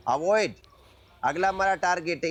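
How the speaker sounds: normal talking voice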